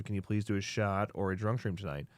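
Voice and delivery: usual speaking voice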